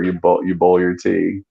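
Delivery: ordinary talk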